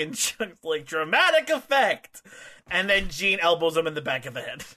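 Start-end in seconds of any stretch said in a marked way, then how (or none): 0.8-2.2 high, slow, hammed-up answer; theatrical declaration. Suge justifies himself to Gene, hammed-up theatrical answer. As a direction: stretch it out grandly, pitched up.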